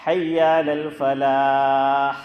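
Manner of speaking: slow, sustained chant with a breathy ح closing "الفلاح"; final ha sounded. Shown as a demonstration. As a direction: draw out "الفلاح" and finish on an audible throaty h. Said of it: "آخری letter کیا ہے؟".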